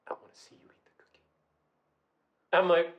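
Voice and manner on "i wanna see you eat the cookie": sinister whispered command; dark creepy date. Retold or imitated. Storyteller imitating her date demanding she eat, creepy whisper. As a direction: imitate a menacing lover in a whisper. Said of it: "He turned dark all of a sudden".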